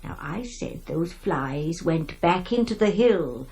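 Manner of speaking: ordinary talk, nothing marked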